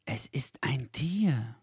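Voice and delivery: hushed, cautious guess; whisper. A narrator's reading. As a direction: whisper it warily. Figur flüstert vorsichtig. (vorsichtig geflüstert)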